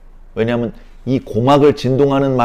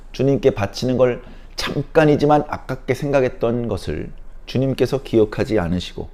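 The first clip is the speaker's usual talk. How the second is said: normal talking voice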